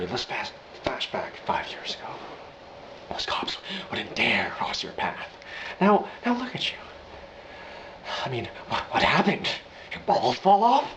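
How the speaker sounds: hushed menace leaping to high mockery; creepy quiet taunt, pitch jumping up at the end; Joker impression. Joker mocking the mobsters, hushed then high.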